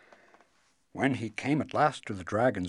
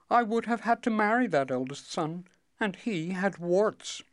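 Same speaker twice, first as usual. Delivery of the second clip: high, bright princess voice; cartoon princess; 9 semitones higher than usual. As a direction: play the pert young princess.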